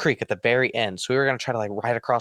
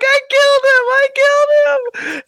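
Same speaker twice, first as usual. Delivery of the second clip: high, very loud, terrified cry; panicked screaming; 8 dB louder, faster than usual. Retold or imitated. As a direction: scream it in total panic, near tears. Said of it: "freaking out".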